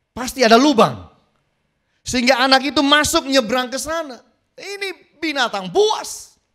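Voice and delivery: high, loud, urgent sermon; alarmed preacher warning of a wild beast; preaching at full force. Acted. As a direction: ring it out across the hall. Preacher stresses the danger.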